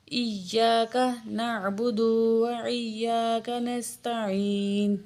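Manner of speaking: slow, melodic tajweed chant; Quranic recitation. Shown as a demonstration. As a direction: recite devoutly, drawing out vowels. Fatiha recitation continues.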